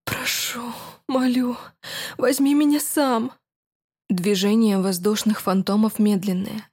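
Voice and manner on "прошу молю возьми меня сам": desperate pleading. Acted. Pleading aroused heroine.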